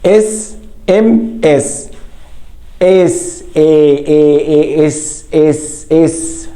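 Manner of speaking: اس stretched slowly to expose its opening "e" sound; very slow اس ام اس, breaking اس down to its first sound; isolating the vowel. Shown as a demonstration. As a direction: draw out and repeat the vowel that starts اس. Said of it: "أنا بنطق ال A".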